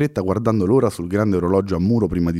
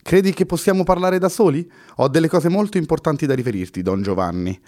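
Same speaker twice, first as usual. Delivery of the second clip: higher, pressing voice cutting in; mock-urgent interruption with an ironic edge; urgent call; 7 semitones higher than usual. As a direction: break into the conversation with urgency. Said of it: "con una certa urgenza acclamò".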